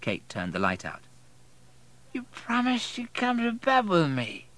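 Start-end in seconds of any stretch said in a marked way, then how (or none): 2.1-4.5 high, slow, sleepy slur; drowsy, drawn-out complaint; drowsy drunk. A narrator's reading. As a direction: mumble it as a drunk drifting off. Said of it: "said Matthew drowsily".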